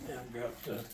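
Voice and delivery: normal talking voice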